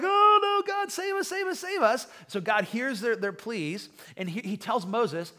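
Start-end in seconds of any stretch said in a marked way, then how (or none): 0.0-2.1 loud, very high mock-panicked wail; hammed-up desperate plea, pitch shooting way up; crying out. Snake-bitten people begging God to save them, crying out. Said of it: "the people start crying out".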